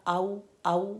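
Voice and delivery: आऊ said as one long vowel without the hamza; merging the hamza and ain into आऊ; wrong reading. Shown as a demonstration. Teacher showing students the mistake: आऊ with hamza lost. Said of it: "अगर हम इसको पढ़ेंगे आऊज़ु बिल्ला".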